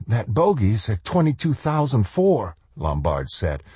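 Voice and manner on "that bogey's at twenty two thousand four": put-on nervous young officer. Lombard reports, nervous.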